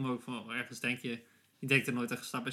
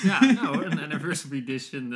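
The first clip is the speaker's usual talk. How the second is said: laughing; 11 dB louder than usual. Real, not acted. Joking about a deluxe cassette.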